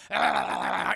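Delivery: loud low demon roar. Retold or imitated. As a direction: growl it out like a ram demon.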